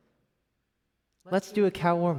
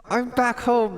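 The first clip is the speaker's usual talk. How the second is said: high, bright cheer; excited; 9 semitones higher than usual. Real, not acted. Telling chat he's back home, high excited cheer. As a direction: gush about being home.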